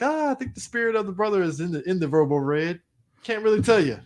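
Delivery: high, light, evasive voice; lightly voiced mock chief shrugging it off. Imitating the chief.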